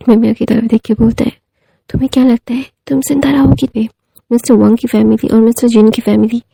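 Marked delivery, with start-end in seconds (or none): 1.9-3.7 threatening whisper. Retold or imitated. Wang ji whispers a threat at Maimiya, menacing whisper. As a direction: breathe the threat right at her.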